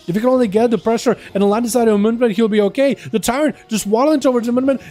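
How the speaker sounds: live play-by-play. Acted. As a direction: call the fight as it happens. Live play-by-play of arena match.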